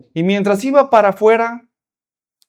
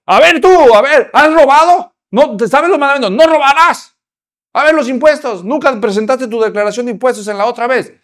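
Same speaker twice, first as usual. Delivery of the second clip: loud, higher accusing questions; stern pastor; 6 semitones higher, 6 dB louder than usual. Acted. Pastor interrogating the rich visitor, loud accusing. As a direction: grill him like an angry pastor.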